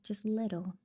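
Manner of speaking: ordinary talk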